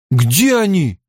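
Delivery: drawn-out, pressing demand. A narrator's reading. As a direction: demand an answer now. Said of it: "Мэтт уже требовал ответа".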